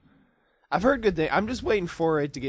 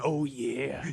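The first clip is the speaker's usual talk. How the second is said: Macho Man; 3 dB quieter than usual. Retold or imitated. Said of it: "when you hear him coming".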